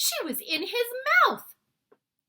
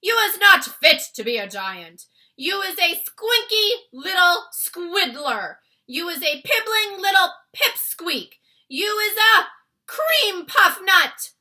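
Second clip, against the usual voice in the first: loud, high giant insults, slowing at the end; insult tirade; 10 semitones higher, 17 dB louder than usual. Acted. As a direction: spit out each insult.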